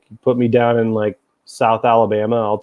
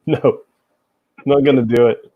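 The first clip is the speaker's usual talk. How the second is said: normal talking voice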